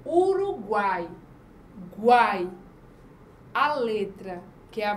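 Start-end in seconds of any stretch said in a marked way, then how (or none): 0.1-2.5 "Uruguai" slowed, then "guai" punched out loud; slow, stressed syllables. Shown as a demonstration. Teacher showing pupils the syllable guai, slow and loud. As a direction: isolate "guai" with a loud hit. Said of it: "Temos aqui, ó".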